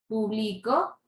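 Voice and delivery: público said as pu-BLI-co, ending on a rise; público with the stress moved to the middle. Shown as a demonstration. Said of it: "toda la fuerza de voz".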